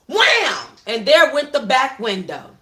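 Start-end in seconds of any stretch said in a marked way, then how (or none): 0.1-0.7 loud wham for breaking glass; smash sound. Acted. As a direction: punch out the impact.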